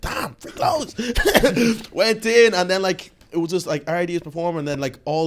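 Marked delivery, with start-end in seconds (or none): 0.0-0.9 high, airy whispered amazement; amazed inner voice. Retold or imitated. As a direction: whisper it like you can't believe it. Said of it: "I was like".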